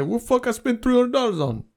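high-pitched pretend disbelief; mock dismay. Spender checking the bank account, mock dismay. Acts out tomorrow's regret.

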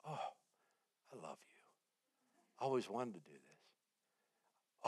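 very soft, low and slow; blissful relief. Retold or imitated. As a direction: melt into hushed, dreamy contentment. Relaxed after sudden peace, acted out.

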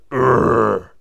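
loud monster grunt; loud growl. Acted. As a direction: growl as you grab someone. Grunt acted as he grabs Jason.

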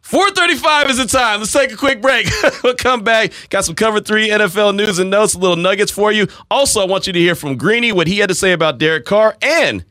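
high, bright announcer read into break; radio break announcement. Acted. Radio host announces the break in announcer style. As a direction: sell the upcoming segment.

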